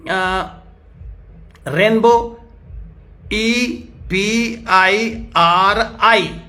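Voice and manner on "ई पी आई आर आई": ई पी आई आर आई spelled out letter by letter, very slowly; slow spelling. Shown as a demonstration. Preacher dictating spelling to the congregation, very slow. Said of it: "मैं धीरे-धीरे बोलूंगा".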